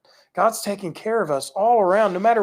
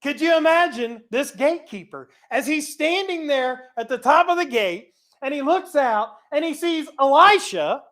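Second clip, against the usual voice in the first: ordinary talk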